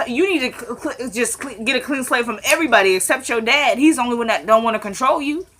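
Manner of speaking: ordinary talk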